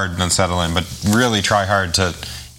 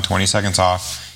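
usual speaking voice

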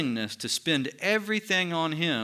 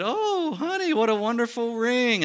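high, sugary gushing compliment; fake gushing praise; 9 semitones higher, 3 dB louder than usual. Praising a friend's ring to her face, falsely gushing. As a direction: gush over it with put-on sweetness.

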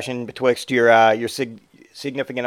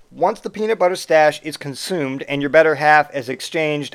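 usual speaking voice

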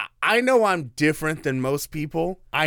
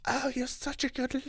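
much higher, soft put-on English voice; English accent; 11 semitones higher, 5 dB quieter than usual. Mocking [name] praising her brother, mock English accent. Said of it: "I'm making her English".

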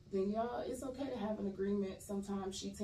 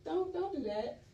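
high-pitched, loud mock indignation; offended; 11 semitones higher, 6 dB louder than usual. Actress retelling her reply to a stranger, offended. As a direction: act out being affronted, half laughing. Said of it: "And so I got offended".

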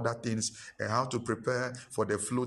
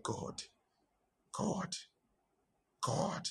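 awed hush; 7 semitones higher, 8 dB quieter, much slower than usual. Retold or imitated. Overwhelmed pastor crying out to God.

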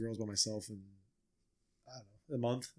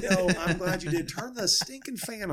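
high, loud laughing protest while gagging; laughing exclaim; 7 semitones higher, 7 dB louder than usual. Real, not acted. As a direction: laugh it out, disgusted but kind. Dad gagging, laughing at stink.

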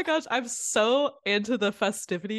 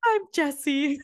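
high, confident holiday greeting; mock festive intro; 9 semitones higher than usual. Host re-enacts her mistaken intro, mock festive confidence. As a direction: send up your own commercial cheer. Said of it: "The confidence level".

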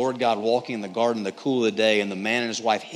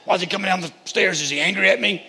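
higher-pitched worried questioning; scared kid; 7 semitones higher, faster than usual. Acted. Kid hearing dad run downstairs, acting scared. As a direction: act an anxious child wondering about dad. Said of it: "You get scared".

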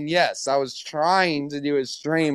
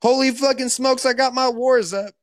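high-pitched mock hype; mock excitement; 9 semitones higher than usual. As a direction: exclaim it high, hamming up the excitement. Excitedly mocking the war talk.

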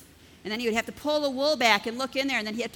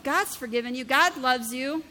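normal talking voice

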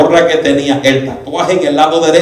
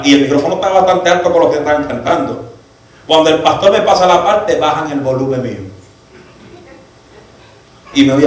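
ordinary talk, nothing marked

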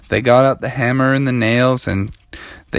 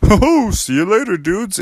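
lightly voiced laid-back bear, pitched up; Brother Bear; 8 semitones higher than usual. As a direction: toss off a jolly, casual goodbye. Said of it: "and Brother Bear said".

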